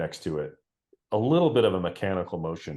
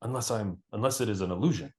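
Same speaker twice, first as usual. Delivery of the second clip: higher, quick voice of a second archer; another archer; 4 semitones higher than usual. Acted. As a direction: point it out to your partner, a bit higher. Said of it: "a different voice say".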